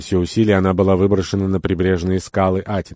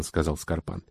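ordinary delivery, nothing marked

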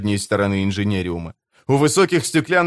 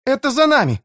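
frightened cry; 14 semitones higher, 3 dB louder, faster than usual. Acted. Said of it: "вскрикнула Кэтрин".

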